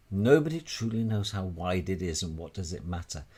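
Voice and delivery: ordinary delivery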